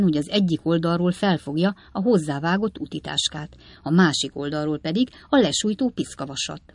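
usual speaking voice

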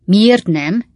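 loud, rising shouted why not; loud indignant shout. Acted. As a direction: fire it back at him. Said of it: "kiáltotta Sam".

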